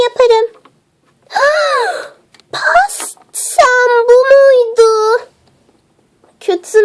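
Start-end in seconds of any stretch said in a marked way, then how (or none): 1.3-2.0 sharp high gasp; shocked gasp. Acted. Shock at the ruined cake. 2.5-5.2 slow, drawn-out wail of dismay. Acted. Sees the ruined cake.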